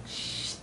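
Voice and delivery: a sharp, breathy shush. Retold or imitated. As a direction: hush someone with a long shh. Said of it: "And I'm just like".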